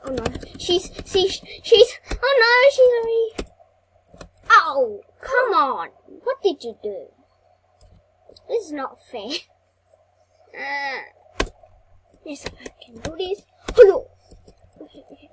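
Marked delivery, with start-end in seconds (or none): none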